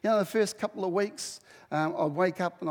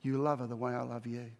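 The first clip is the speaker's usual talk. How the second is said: softer, lower gentle reply; soft voice of God; 6 semitones lower, 5 dB quieter than usual. Retold or imitated. God answering Russell, soft and low. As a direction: speak it softly as God. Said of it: "God whispering to me".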